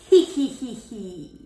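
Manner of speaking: sly little giggle in a changed voice; giggling squirrels. Acted. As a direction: snicker like a hiding squirrel.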